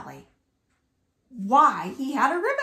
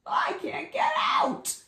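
frightened frog; 13 semitones higher than usual. Acted. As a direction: panic up high, calling from the hole. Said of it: "croaked Frog".

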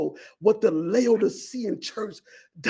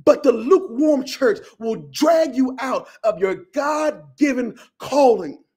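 ordinary talk, nothing marked

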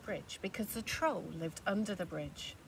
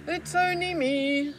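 higher, louder confident goat; bold big goat; 8 semitones higher, 11 dB louder, slower than usual. Acted. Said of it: "said the big billy goat Gruff".

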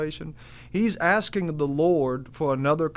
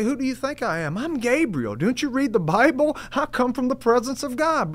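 higher, exasperated Gabriel scolding; indignant and pitched up; frustrated angel; 8 semitones higher than usual. Acted. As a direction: scold him like an annoyed angel. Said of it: "he gets a little frustrated with Zacharias".

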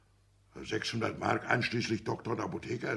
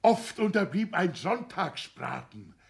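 reproachful outburst; 8 semitones higher, 4 dB louder than usual. Acted. Father reproaching his son for their sacrifices, loud. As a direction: pile on the guilt, rising.

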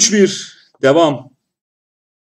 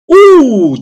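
surprised; 11 semitones higher, 11 dB louder than usual. Real, not acted. Shot hits the post.

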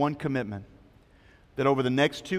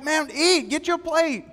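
much higher, eager urging; pushy friends; 13 semitones higher, slower than usual. Retold or imitated. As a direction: coax him over to the food. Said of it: "his friends are like".

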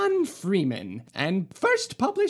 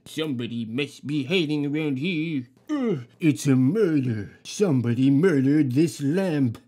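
low, gruff night watchman demanding an answer; 9 semitones lower, 4 dB louder than usual. Acted. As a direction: play a suspicious guard barking a question. Watchman voiced in story.

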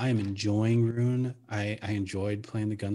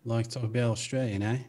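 put-on Aussie accent; Australian accent. Shown as a demonstration. Player showing off for impressed friends, Australian accent. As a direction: slip into broad Australian. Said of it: "That's pretty good".

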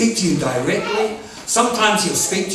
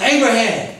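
higher-pitched call to someone; 5 semitones higher than usual. Retold or imitated. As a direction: call his name out from afar. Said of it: "he called out to him".

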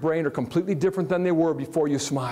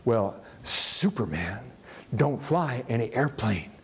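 a sly put-down, slowed for effect; quick comeback; 4 semitones lower than usual. Retold or imitated. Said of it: "she was quick and she said".

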